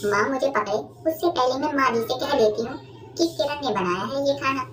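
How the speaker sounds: usual speaking voice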